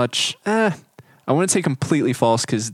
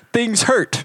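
much higher, whiny "things hurt"; mock whine; 10 semitones higher than usual. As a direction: whine it like a sore runner. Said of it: "And I'm like".

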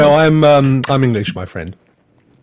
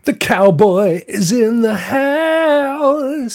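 slow, drawn-out higher hype call; announcer shout-out; 9 semitones higher, much slower than usual. Acted. Welcoming the cowboy viewer to the stream, slow and hyped. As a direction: announce the cowboy's entrance big.

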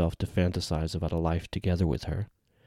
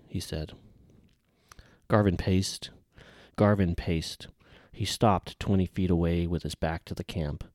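plain reading voice

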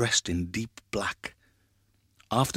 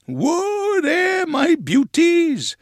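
high, hearty cry to the horses; slow, drawn-out driver's call, pitched high; 5 dB louder, much slower than usual. A narrator's reading. Grandpa to his invisible horses in bed, crying out. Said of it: "cried Grandpa".

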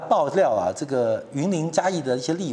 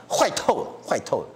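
ordinary delivery

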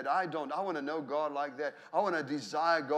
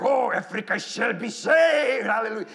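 booming evangelist; 4 semitones higher, 8 dB louder than usual. Retold or imitated. Evangelist preaching across the lunch table, imitated booming shout. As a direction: proclaim it at full force, imitating a fiery evangelist. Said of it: "he's, again, he's like".

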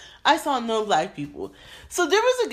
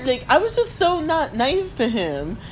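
usual speaking voice

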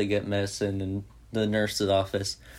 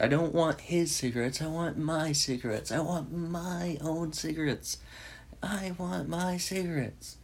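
high, rising whine that fades softer and softer; panicked pleading; 7 semitones higher, 4 dB quieter than usual. Retold or imitated. Glasses pleading with the nurse, high panicked whine. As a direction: play Glasses begging for his own cigarettes. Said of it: "totally freaking out".

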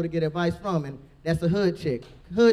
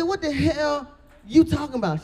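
higher, put-on indignant voice; mock indignant; 9 semitones higher than usual. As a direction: act offended in a raised voice. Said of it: "I said".

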